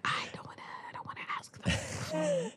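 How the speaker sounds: hushed, breathy refusal; whisper. Retold or imitated. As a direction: whisper it so nobody else hears. Refusing to perform, retold.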